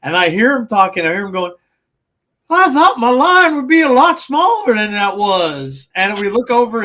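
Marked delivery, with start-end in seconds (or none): 2.5-5.9 slow, higher, louder gruff-actor voice; a celebrity overheard at a convention, in a mild impression; Michael Rooker. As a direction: imitate a movie actor chatting with fans, a little slower. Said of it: "it's Michael Rooker".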